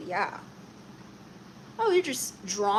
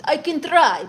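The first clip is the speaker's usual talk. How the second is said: loud, higher put-on Russian accent; 4 semitones higher, 11 dB louder than usual. Streamer obliging a viewer's request, put-on Russian accent. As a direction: launch into a mock Russian accent. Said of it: "Can you do a Russian accent?".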